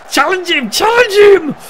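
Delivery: loud, high-pitched desperate yell; frantic urgent shout. Real, not acted. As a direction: scream at your defender to step in. Urging players to stop an attack.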